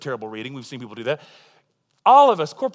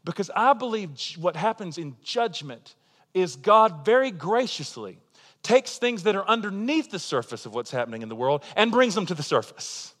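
ordinary delivery

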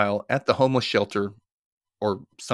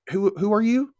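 puzzled; 10 semitones higher than usual. Retold or imitated. Acting Graham puzzled by visitor.

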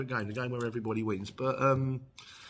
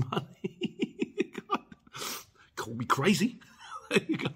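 laughing; 8 semitones higher, slower than usual. Real, not acted. Laughing at own joke.

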